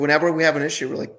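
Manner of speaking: ordinary talk, nothing marked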